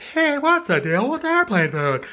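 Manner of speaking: high, loud comic delivery; stand-up comedian. Acted. Comic supposed to open his act, loud high stand-up voice. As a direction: throw out the setup in a showy comedian voice. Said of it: "wants to be a stand-up comedian".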